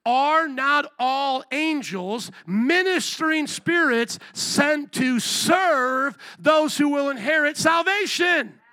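slow reading that swells into a shout; building shout. Acted. Preacher to congregation, slow verse building to a shout. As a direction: read the verse slow and let it explode.

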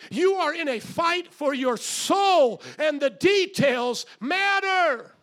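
high, loud, slow preaching shout. Real, not acted. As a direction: thunder it at the church. Preaching climax at full force.

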